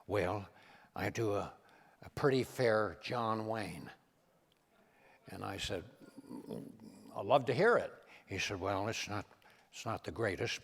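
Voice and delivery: imitating Reagan, low and soft; hushed, folksy Reagan impression; Ronald Reagan. Reagan confiding to the comedian about his impressions, low and soft. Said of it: "Mister President".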